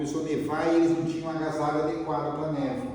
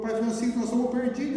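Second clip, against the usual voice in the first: put-on crying boy; 9 semitones higher, faster than usual. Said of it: "começou a chorar".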